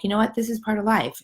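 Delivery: putting a smile on mild frustration; smiling through it. Retold or imitated. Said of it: "I was smiling through it".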